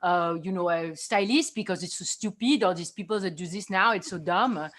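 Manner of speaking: disdainful style architect in a put-on accent; Calabi Champignon. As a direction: play a snobbish fashion character with a foreign accent. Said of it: "the Calabi Champignon".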